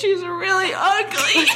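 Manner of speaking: slow, high, laughing quote. Retold or imitated. Quoting the boyfriend's reply, laughing high and slow. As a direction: deliver his line through laughter.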